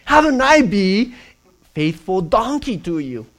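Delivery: high, loud pleading donkey. Retold or imitated. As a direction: cry out like a hurt donkey. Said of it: "the donkey voice".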